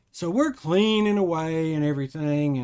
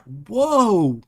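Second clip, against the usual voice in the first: sudden loud, higher whoa of alarm; startled whoa; 5 semitones higher, 6 dB louder than usual. Retold or imitated. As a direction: caught off guard as the floor shifts. Floor moves under him.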